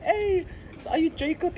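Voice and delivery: very high, eager question; imitating student. Sixth form student asking Jacob, high eager voice. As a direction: ask it like a starstruck kid. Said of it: "he was like".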